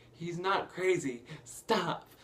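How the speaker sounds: acted-out urgent objection; mock protest. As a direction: loudly plead in someone's defence. Retold Belle protesting to the crowd.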